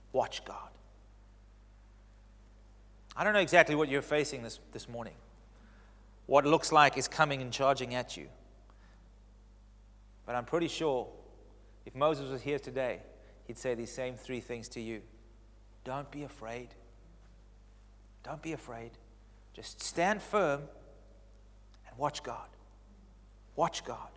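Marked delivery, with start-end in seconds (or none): 0.1-0.7 whispered. Shown as a demonstration. Preacher repeating Moses' command in a whisper. 15.8-18.9 reassuring. Shown as a demonstration. 19.5-20.7 slow. Shown as a demonstration.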